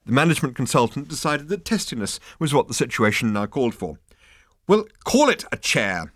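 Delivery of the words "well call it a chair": testy. A narrator's reading. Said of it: "he said testily".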